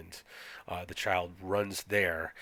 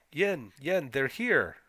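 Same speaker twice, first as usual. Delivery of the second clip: acted child's yell, high and a bit louder; 7 semitones higher, 4 dB louder, slower than usual. Child shouts for Yen that visitors came, mock high yell. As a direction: call out like an excited child, restrained volume. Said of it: "the child is yelling".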